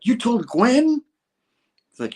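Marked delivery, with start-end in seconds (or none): 0.1-1.0 high, loud mock disbelief; retold outburst of disbelief; incredulous. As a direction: act out shock at the news, pitch leaping up. Acted shock at a confession.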